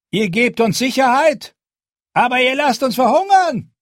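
high, loud and bitter reproach; stocky spearwife. Acted. Spearwife accuses Jon in front of the crowd, loud and bitter. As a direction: throw the accusation back at him. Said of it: "sagte eine stämmige Frau".

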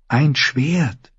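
put-on high girl's voice, firm answer; resolute, high-voiced reply; determined girl. A narrator's reading. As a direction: pitch it high like a young girl.

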